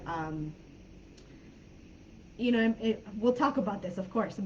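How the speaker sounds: ordinary talk, nothing marked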